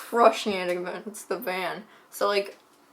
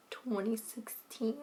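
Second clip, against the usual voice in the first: whispered; 12 dB quieter, slower than usual. Real, not acted. Date trailing into whisper.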